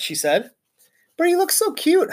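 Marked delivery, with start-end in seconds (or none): 1.2-2.1 put-on high, sweet little girl's call. Heidi calling out to the teacher about the hamster. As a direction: call out adoringly. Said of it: "Heidi called out".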